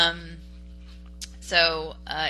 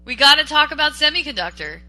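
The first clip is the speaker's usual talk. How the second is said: emphatic; 8 semitones higher, 6 dB louder than usual. Real, not acted. Host repeats loudly for emphasis.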